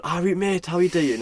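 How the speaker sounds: loud, high Geordie greeting; Geordie accent. Retold or imitated. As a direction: call out cheerily in Geordie.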